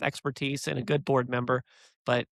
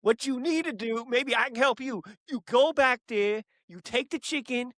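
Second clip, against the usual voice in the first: New Jersey gangster; 8 semitones higher than usual. Retold or imitated. Scary gangster instructing the kid at the register, hammed-up Jersey voice. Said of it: "New Jersey guy looking like a gangster".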